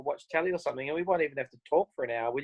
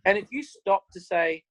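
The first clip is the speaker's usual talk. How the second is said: ordinary talk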